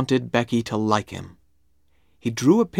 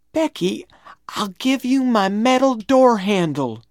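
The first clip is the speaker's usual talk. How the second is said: high-pitched, desperate coaxing; coaxing plea; 11 semitones higher, 4 dB louder than usual. A narrator's reading. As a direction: coax her with a gift, voice high. Tom bargains with crying Becky.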